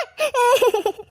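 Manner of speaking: short burst of stuttering sobs; wordless acted weeping; mother's sobbing. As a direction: sob after a bitter complaint. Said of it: "அம்மா, அழாதீங்கம்மா".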